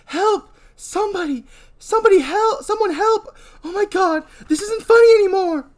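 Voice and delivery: high, loud desperate screams; yelling for help. Acted. Donna trapped at dead end, screaming for help. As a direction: scream for rescue, crying. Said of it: "Donna yells".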